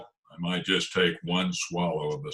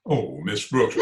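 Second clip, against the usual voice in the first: usual speaking voice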